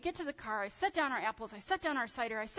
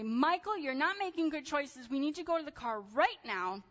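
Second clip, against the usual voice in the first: higher, louder firm telling-off; stern mom scolding; 5 semitones higher, 4 dB louder than usual. Retold or imitated. Mother scolding her son in the orchard, firm raised voice. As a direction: lay down the law to your kid.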